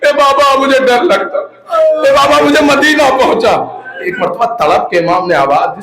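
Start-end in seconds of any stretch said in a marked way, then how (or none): 0.1-3.8 put-on higher, plaintive child's plea; pleading four-year-old girl. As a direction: beg father like a frightened little daughter. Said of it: "کون چار سال کی بچی کہ".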